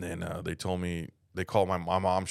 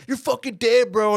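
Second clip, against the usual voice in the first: squeaky high louder kid voice, imitating; high mock kid threat; 14 semitones higher, 6 dB louder than usual. Retelling the kid's parting threat, high and mocking. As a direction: play the bratty kid yelling a threat. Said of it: "Like a little kid saying that to me".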